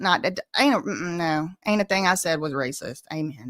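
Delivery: ordinary talk, nothing marked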